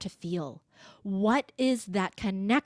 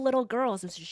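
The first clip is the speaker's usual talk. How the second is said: ordinary talk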